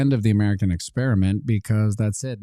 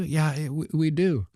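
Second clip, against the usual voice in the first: meek citizen; 8 semitones higher than usual. Retold or imitated. Citizen answering meekly.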